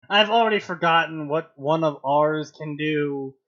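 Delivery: earnest, declaiming read of a hero's vow; lightly hammed-up heroic monologue; dramatic fan fiction reading.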